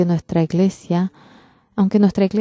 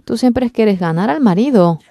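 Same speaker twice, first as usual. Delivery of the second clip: usual speaking voice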